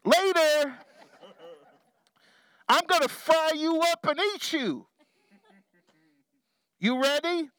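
hammed-up fisherman bellowing to fish; loud call to fish. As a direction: shout down into the water at the fish. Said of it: "call out in a loud voice".

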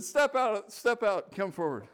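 loud, high command; commanding call. Retold or imitated. Speaker summoning him forward, loud command. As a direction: order him forward from the stage.

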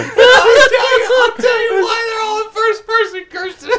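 high, breaking with laughter; laughing through words. Real, not acted. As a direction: try to speak while cracking up. Mock lecture, cracking up.

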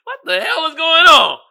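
high squeaky disbelief rising to loud; comic bewildered yelp; baffled outburst. Acted. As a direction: start high and incredulous, end hollering. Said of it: "You're like".

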